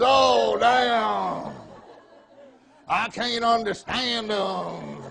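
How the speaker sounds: high, drawn-out mock groaning complaint; slow, hammed-up Southern dismay; dismayed audience member. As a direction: imitate a listener moaning in dread. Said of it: "seen your faces like".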